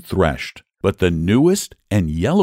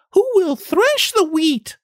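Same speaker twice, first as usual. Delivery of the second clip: much higher, slow hopeful call; hopeful hen. Acted. Hen hopefully asks the animals to thresh, high slow call. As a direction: ask as if sure someone will help. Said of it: "in a very hopeful tone".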